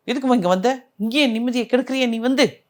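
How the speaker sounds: mild, high-pitched scolding; retold angry yelling. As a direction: imitate a daughter lashing out at her mother. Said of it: "அப்படின்னு சொல்லி கத்த ஆரம்பிச்சது".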